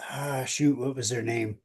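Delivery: normal talking voice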